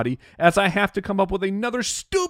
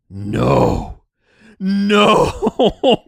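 dread-filled no; 2 semitones higher, 6 dB louder than usual. Retold or imitated. Dreading wife's elf reminder.

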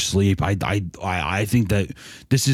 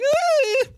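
high scream. Retold or imitated. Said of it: "She's like".

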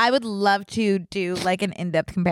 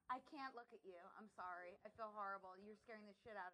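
off mic; 30 dB quieter, faster than usual. Real, not acted. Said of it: "Can you speak into the mic?".

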